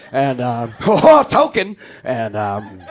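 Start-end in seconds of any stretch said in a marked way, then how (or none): 0.9-1.8 high, loud dwarf exclamation; Gimli. Acted. Mock dwarf arriving at the store, hearty cry. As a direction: burst out like a hearty fantasy dwarf. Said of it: "here comes Gimli, son of Gloim".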